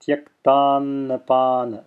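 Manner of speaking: tyaktannapana slowly, with a long voiced first n; extended first n. Shown as a demonstration. Teacher showing the extension, long first n in tyaktannapana. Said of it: "See how I'm extending that first n".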